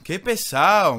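drawn-out whine. Real, not acted. Complaining after being killed.

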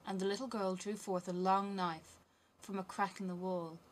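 usual speaking voice

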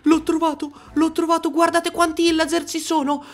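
very high, gasping disbelief; amazed discovery. Real, not acted. Player spots the captured sea dragon, amazed. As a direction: start loud and squeal-high, stay high as it sinks in.

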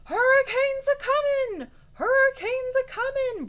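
very high, loud panicked yell; yelling alarm. Acted. Rabbit yelling to the bear that a storm is coming, alarmed. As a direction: yell the warning like a storm is on you. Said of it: "Breyer Rabbit yelled".

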